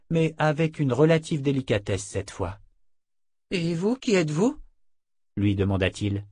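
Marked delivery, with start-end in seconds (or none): 3.5-4.6 put-on high young voice, relieved; a teenage boy, voiced higher; boy's voice. A narrator's reading. Boy learning Gadi died, high and relieved.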